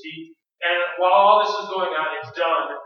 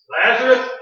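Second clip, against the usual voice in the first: loud, raised summons to the dead; calling out; 4 semitones higher, 8 dB louder than usual. Acted. Preacher voices Jesus summoning Lazarus, calling out. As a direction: call him out with authority.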